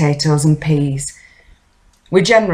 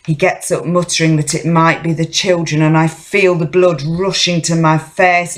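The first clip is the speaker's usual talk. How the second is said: ordinary delivery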